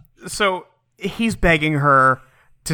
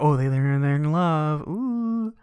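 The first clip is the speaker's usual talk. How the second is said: mock swoon; 4 dB quieter, slower than usual. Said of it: "secret man writing for".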